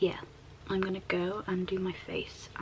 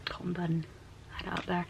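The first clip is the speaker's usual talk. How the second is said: whispering; 5 dB quieter than usual. Real, not acted.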